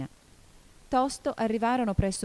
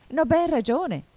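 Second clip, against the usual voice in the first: high, louder retort; lively, slightly annoyed; 10 semitones higher, 7 dB louder than usual. A narrator's reading. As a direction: answer back briskly with a touch of irritation. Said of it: "rispose vivamente Alice, perché cominciava a sentirsi un po' seccata". (risposta vivace, un po' seccata)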